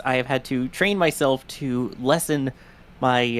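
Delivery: usual speaking voice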